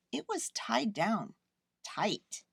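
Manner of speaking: ordinary delivery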